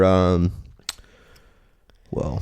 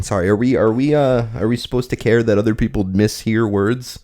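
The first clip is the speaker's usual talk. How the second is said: ordinary delivery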